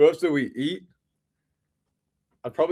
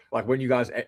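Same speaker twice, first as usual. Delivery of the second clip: normal talking voice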